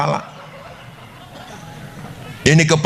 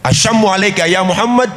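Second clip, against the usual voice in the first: taunting greeting; 6 semitones higher than usual. Acted. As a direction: sneer out the greeting. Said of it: "Kalau kita dikata begitu".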